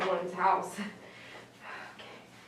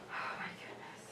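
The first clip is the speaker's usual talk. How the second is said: whisper; 18 dB quieter than usual. Real, not acted. Whispered repeat of own words.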